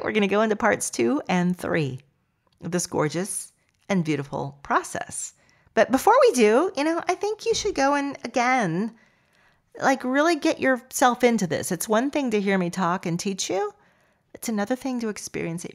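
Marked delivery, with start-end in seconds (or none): none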